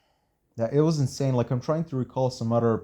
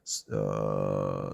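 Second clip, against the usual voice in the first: normal talking voice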